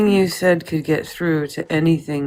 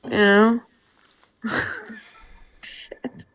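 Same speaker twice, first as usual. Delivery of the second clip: ordinary delivery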